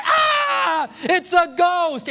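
high, loud mock scream of fright; mock terrified cry. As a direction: shriek it like she's seen a ghost. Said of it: "she cries out".

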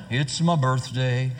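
low, slow, mopey donkey voice; gloomy Eeyore. Retold or imitated. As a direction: drag it out like a glum Eeyore. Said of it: "he's such an Eeyore".

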